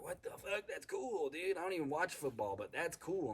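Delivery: high-pitched amazed exclamation; high excited disbelief, then delight. Retold or imitated. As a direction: gush about it in a high amazed voice. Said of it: "I got really excited".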